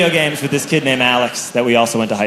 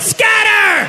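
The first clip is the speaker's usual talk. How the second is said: much higher, sharp yell; yelled command. Retold or imitated. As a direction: shout it like a general ordering retreat.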